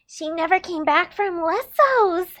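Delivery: usual speaking voice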